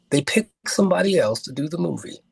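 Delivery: ordinary talk, nothing marked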